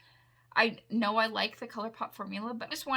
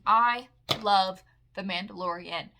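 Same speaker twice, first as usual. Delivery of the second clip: usual speaking voice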